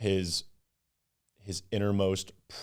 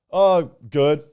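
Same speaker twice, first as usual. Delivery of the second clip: shrugging reply; 8 semitones higher, 11 dB louder than usual. Retold or imitated.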